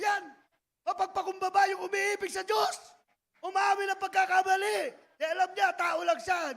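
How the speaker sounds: loud, driving preacher's voice through every line; loud preaching. Acted. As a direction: preach it like the praise itself, full volume. Preacher at full force. (malakas at masiglang pangangaral)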